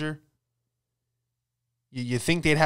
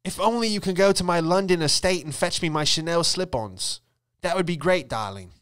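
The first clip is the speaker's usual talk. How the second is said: posh socialite; 6 semitones higher than usual. Retold or imitated. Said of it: "Maxwell voiced as posh socialite".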